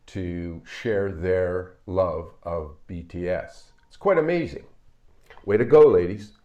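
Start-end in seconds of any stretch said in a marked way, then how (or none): 3.9-6.3 bright, raised cheer, much louder on the last words; excited cheer. Real, not acted. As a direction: cheer the ladies on with real delight. Host cheering the elder aunties.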